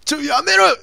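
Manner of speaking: loud high shout of disgust; startled yell. Real, not acted. Streamer yelling at a gory zombie on screen, loud and high. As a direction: yell it out, recoiling from the gore.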